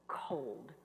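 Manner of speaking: low, hushed, drawn out; stunned whisper. Real, not acted. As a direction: breathe the word out frozen. Frozen in shock.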